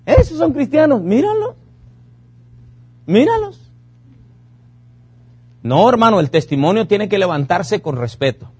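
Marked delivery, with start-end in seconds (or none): 0.0-3.6 high, sneering gossiping neighbour; mock the hypocrite Christians, much higher; pointing and jeering, voice pitched way up. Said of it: "Cuando la gente dice".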